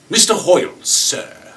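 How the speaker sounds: high, loud British address; British kid. Acted. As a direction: pipe up as an earnest young Brit. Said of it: "this Brit kid that works for us".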